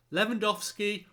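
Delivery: words stretched by a real yawn; yawning mid-sentence, worn out from the match. Real, not acted. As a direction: talk through a yawn, tired. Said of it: "sorry, I'm yawning".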